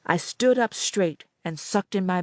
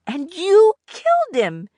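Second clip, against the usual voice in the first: much higher, louder accusation; shocked young girl; 11 semitones higher, 8 dB louder than usual. A narrator's reading. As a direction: cry out the accusation. Annette accuses Rhoda.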